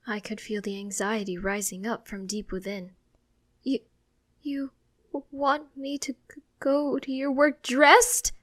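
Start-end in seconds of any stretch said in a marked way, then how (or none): 3.6-8.3 higher, slow, stumbling in panic; anxious stammer. Acted. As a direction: stutter it out in dread. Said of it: "I fret".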